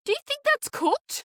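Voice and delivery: high, disbelieving question; incredulous. Real, not acted. Asking the friend to agree, high and disbelieving. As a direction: make it sound absurd.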